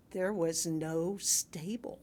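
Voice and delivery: slow, hushed whisper; dramatic whisper. Acted. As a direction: let the truth drop in a breathless whisper. Dramatic reveal in sermon.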